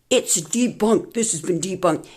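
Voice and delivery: mocking debunkers. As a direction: parrot the fact checkers with scorn. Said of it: "flooding the internet with".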